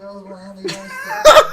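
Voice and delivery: shouting it out through a laugh; loud, indignant, half laughing. Real, not acted. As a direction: yell the denial while cracking up. Heated denial, laughing shout.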